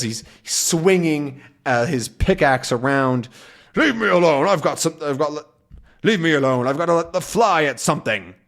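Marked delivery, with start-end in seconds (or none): 3.7-8.3 angry captain. Acted. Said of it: "he says".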